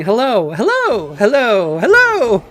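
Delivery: mock himself chanting hello like a fool; silly repeated hello. Showing how dumb he sounded to his girlfriend, silly repeated greeting. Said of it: "it's like me sitting here saying".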